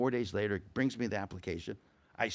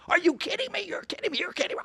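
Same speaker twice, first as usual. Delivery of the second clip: very high, hysterical disbelief; professor going nuts; 6 dB louder than usual. Retold or imitated. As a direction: screech like a professor losing it. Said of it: "he's going nuts".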